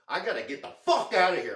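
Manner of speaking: reenacted determined outburst; adamant demand. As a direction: insist hard on getting out, leaning on the swear. Insisting on leaving the hospital.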